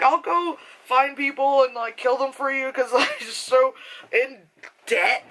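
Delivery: high-pitched, joking desperation; mock desperate. Broke viewer offering favours for cash, mock desperate. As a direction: plead up high, half laughing at yourself.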